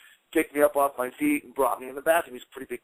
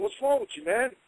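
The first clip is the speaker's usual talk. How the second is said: higher, concerned; voicing Billy, puzzled and higher; imitating Billy; 7 semitones higher, 3 dB quieter than usual. Billy asking him what's wrong, higher and concerned.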